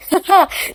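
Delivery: a quick bright laugh; short joyful laugh. A narrator's reading. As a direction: laugh with sudden happy relief. Joy at new pregnancy.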